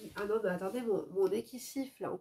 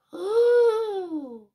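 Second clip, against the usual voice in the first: high, loud shocked gasp of "Oh!"; shocked cry; 9 semitones higher, 8 dB louder than usual. Real, not acted. Reacting to the father hitting the wall, a shocked loud cry. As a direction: let out a sudden, outraged cry.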